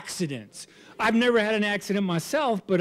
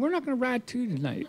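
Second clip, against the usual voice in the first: hushed reply; 6 semitones higher, 7 dB quieter than usual. Retold or imitated. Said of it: "I said".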